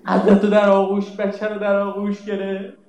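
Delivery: ordinary talk, nothing marked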